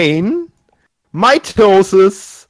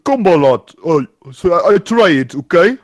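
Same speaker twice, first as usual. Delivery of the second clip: a hammed-up, mixed Russian-German accent. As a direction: play a clumsy Russian comrade. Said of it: "Bird Life explained by a Russian".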